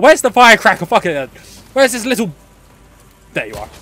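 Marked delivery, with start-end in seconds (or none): none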